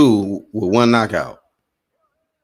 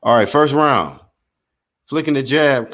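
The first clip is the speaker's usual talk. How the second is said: play-by-play; 6 semitones higher than usual. Acted. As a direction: lively fight commentary, pitched up on the big shots. Live play-by-play of the fight.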